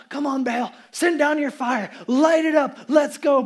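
loud, pleading yells to an idol; frantic calling out. Retold or imitated. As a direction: shout up at a silent god. Prophets voiced crying to Baal.

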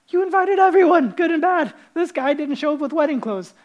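high, loud indignant shout; outraged king. Acted. King bellows at the guest without wedding clothes, loud and high.

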